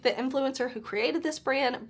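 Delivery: ordinary delivery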